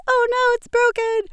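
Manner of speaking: high, loud mock panic; mock dismay. Host retelling her broken mic, mock panicked squeal. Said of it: "I'm like".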